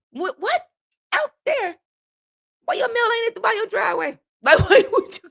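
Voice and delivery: high squeaky disbelief; shrieky, baffled protest breaking into laughter; incredulous. Retold or imitated. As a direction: question it in comic shock. Acted shocked reply to directions.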